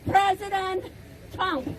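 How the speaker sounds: ordinary talk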